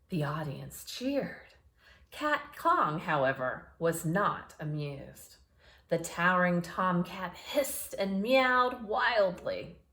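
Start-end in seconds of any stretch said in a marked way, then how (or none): none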